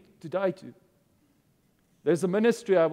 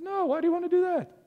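mock innocent reply; 10 semitones higher, faster than usual. Pastor's mock answer repeated.

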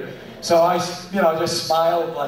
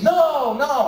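high, insistent mimicked kids; imitated schoolchildren, voice pitched well up; class of kids; 8 semitones higher than usual. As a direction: answer like a roomful of children. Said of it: "They said".

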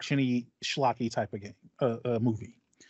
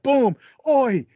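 high, loud, laughing cockney outrage; mock-outraged cockney, high and loud; cockney accent; 8 semitones higher, 3 dB louder than usual. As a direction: yelp it like a cockney whose car just got hit. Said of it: "accent there".

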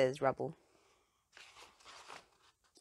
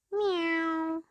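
high, loud frightened meow; cat's cry; 13 semitones higher, 5 dB louder than usual. A narrator's reading. Callie the cat crying out as she slips, high and loud. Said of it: "she cries".

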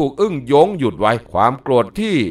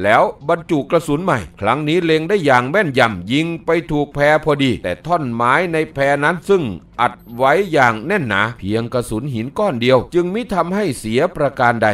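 plain reading voice

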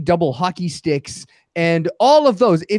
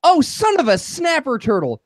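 higher-pitched toe-stub outburst; hammed-up yelp in place of cursing; mock pained exclamation; 7 semitones higher than usual. Imitating non-swearers who stub their toe, mock pained. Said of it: "they'll stub their toe and they'll go".

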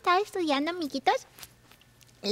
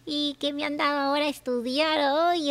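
ordinary talk, nothing marked

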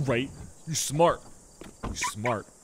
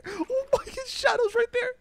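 much higher, frantic cries of please and no; terrified pleading. Real, not acted. Gamer cornered by the shotgun guy, shrieking pleas. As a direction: beg for your life in mock terror. Shadow near, begging in game.

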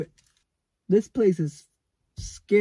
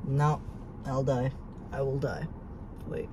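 ordinary talk

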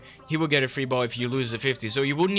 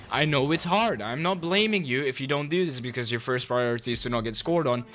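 normal talking voice

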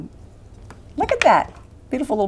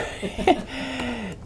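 bright real laugh; 7 semitones higher, 5 dB quieter than usual. Real, not acted. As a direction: burst out laughing. Laughing at dog name mixup.

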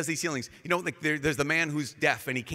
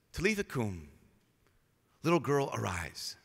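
gentle command; 4 semitones lower than usual. Retold or imitated. Jesus raises the girl.

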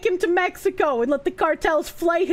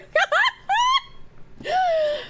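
very high, squealing burst of laughter; incredulous laugh. Real, not acted. Reader cracking up at the decoy's insult, incredulous laugh. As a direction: crack up helplessly, high-pitched.

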